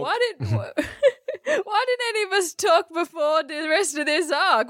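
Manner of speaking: laughing through the words, near tears. Real, not acted. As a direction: gasp it out while cracking up. Laughing so hard while talking.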